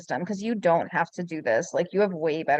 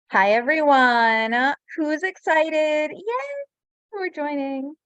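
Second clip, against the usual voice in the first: loud, drawn-out, excited hello to the crowd; slow, loud cheer that jumps high on yay; excited greeting; 7 semitones higher, 5 dB louder, slower than usual. Real, not acted. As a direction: hype up the webinar audience. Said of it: "I am so excited".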